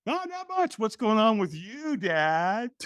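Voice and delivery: a nine-year-old pretending he knows nothing; kid voice. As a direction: son acting innocent on the phone with his dad, high and coy. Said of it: "Davey's like".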